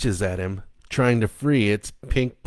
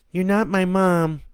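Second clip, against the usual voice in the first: put-on high, louder child's refusal; defiant little girl; 11 semitones higher, 4 dB louder than usual. Stephanie telling the creature it is not her mom.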